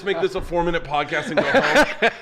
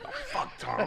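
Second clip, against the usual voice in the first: ordinary delivery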